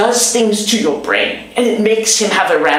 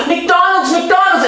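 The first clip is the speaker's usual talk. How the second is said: gasp, then shout it much higher, thrilled; excited kids shouting; 12 semitones higher than usual. Retold or imitated. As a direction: squeal it like a kid promised a treat. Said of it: "they scream McDonald's".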